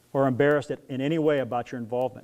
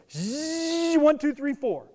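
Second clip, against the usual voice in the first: race whoosh; 11 semitones higher, much slower than usual. Pretend. Imitating the race rushing past.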